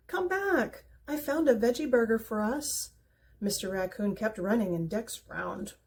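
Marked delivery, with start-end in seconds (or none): 0.1-2.9 calling out high and a bit louder; higher, louder call across the distance; shouting after him. Acted. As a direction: call after the raccoon as he runs off. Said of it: "he shouted".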